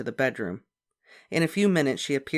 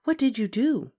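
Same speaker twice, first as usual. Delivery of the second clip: librarian; 10 semitones higher, 4 dB louder than usual. A narrator's reading. Librarian voiced.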